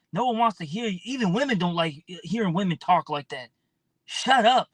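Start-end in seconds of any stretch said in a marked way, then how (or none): 4.1-4.7 sharp shout. Acted. Snapping at women.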